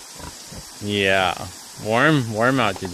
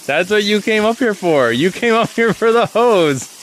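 delighted pet talk; 8 semitones higher, 5 dB louder than usual. Real, not acted. Delighted baby talk to pig.

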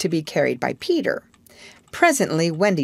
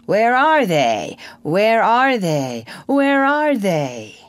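slow, loud echoing call; booming, drawn-out menace; savage cry; 3 semitones higher, 4 dB louder, slower than usual. A narrator's reading. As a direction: cry it savagely, stretching each word, climbing at the last. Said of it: "the echoes seemed to cry savagely".

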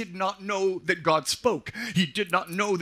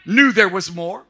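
fiery preaching; 3 semitones higher, 6 dB louder than usual. Acted. Pastor to congregation, fiery preaching. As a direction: lift the congregation up.

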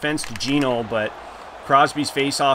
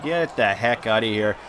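ordinary talk, nothing marked

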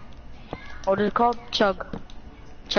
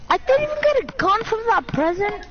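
indignant; 9 semitones higher than usual. Real, not acted. Complaining about missing gun.

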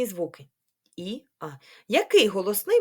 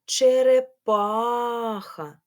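ЧЕРЕПАХА very slowly, ПА held long, louder and higher; stress on ПА; 4 semitones higher, 4 dB louder, much slower than usual. Shown as a demonstration. Teacher asking which vowel stands out, ПА stretched. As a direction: hold the А in ПА of ЧЕРЕПАХА. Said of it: "голосний а".

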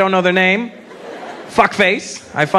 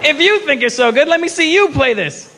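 challenging; 8 semitones higher than usual. Retold or imitated. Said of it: "So I'm like".

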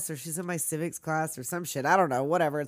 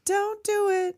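much higher mock warning; high put-on voice; 11 semitones higher, slower than usual. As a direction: squeak it like a cartoon. Echoes line in character voice.

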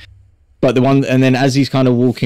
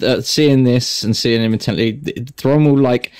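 ordinary delivery

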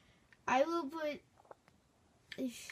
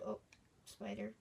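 ordinary talk

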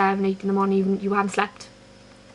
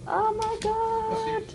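excited; 13 semitones higher, much slower than usual. Real, not acted. Dog excitement continues.

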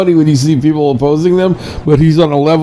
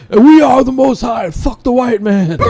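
mock street preacher shout; 9 semitones higher than usual. Mocking the street preachers he watches, mock shout. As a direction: ham up a ranting street preacher. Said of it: "start shouting shit like these guys".

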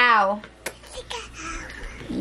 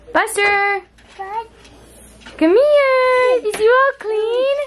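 calling out; 9 semitones higher, 5 dB louder, slower than usual. Real, not acted. Calling over freshly bathed pet.

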